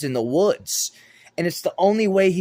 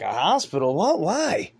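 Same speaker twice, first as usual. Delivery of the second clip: rising, baffled questions piling up; bewildered, each question climbing higher; a man waking up lost in a hospital; confused questions; 4 semitones higher, slower than usual. Retold or imitated. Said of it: "I'm like".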